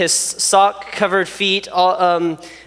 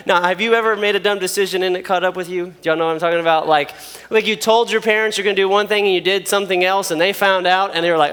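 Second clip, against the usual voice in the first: normal talking voice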